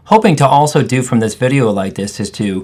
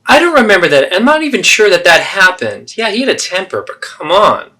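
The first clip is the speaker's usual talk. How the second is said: much higher, louder scoffing denial; dismissive mother; 9 semitones higher, 5 dB louder than usual. Acted. Mother denying the abuse to her daughter, scoffing. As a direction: brush it off with a scoff. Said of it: "So there you have the three role plays".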